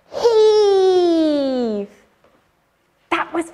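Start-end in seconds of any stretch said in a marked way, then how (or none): 0.1-1.9 loud straining shout of heave; hauling a rope. Shown as a demonstration. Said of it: "one, two, three".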